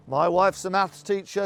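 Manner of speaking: plain reading voice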